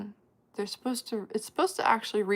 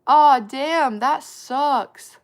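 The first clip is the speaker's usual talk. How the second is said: loud sympathetic aw; 4 semitones higher, 13 dB louder, much slower than usual. Real, not acted. Reacting to a sad chat message.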